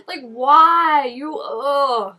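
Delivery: ordinary talk, nothing marked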